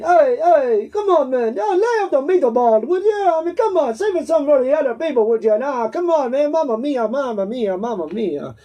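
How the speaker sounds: hammed-up, amateur Italian accent; animated mock Italian accent, rising and falling. Shown as a demonstration. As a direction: ham it up as a cartoon Italian. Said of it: "there's that Italian".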